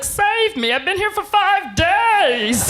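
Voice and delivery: high, stretched wail for rescue; hammed-up desperate cry; desperate plea. Man clinging to her leg pleads.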